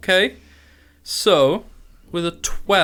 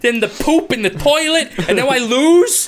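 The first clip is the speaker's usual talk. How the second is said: high, exasperated rant; Bill Burr rant; 9 semitones higher than usual. Acted. Goblin ranting about his awful day, exasperated. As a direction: vent every grievance. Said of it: "are you channeling Bill Burr".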